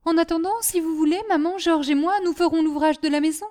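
high, lightly voiced eager girl; eager child. Daughter offering to do housework, eager. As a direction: offer mother your help keenly.

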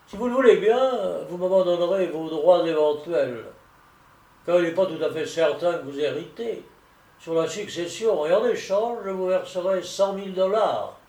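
emphatic solicitor's voice, high loud start; persuasive solicitor. Acted. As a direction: press the offer point by point. Narrator voices solicitor making his offer. (voix insistante de solliciteur persuasif)

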